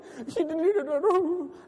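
high-pitched imitation of speaking in tongues; mock frantic babble. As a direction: babble nonsense syllables in mock fervor. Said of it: "they want to shunda".

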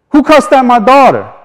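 high, loud furious demand; angry father yelling. Retold or imitated. Angry father confronting the garbage crew, yelling. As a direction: bark it out like an enraged dad.

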